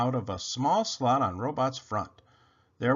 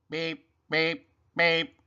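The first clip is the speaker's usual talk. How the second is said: even, higher-pitched machine beeps; robot beeping; 4 semitones higher, much slower than usual. A narrator's reading. Robot beeps at searching Rabbit, monotone higher beeps. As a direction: beep on one note, evenly spaced. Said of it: "beeped the Robot".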